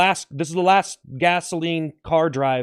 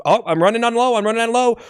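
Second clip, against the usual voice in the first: higher-pitched worried call; mock alarm; 7 semitones higher, 4 dB louder, faster than usual. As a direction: call out a pretend emergency. Plays a car running out.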